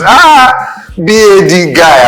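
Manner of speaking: high, loud, slow mock street greeting; Nigerian guy greeting. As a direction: hype up a mate like a Naija guy. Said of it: "I don't want to say the word".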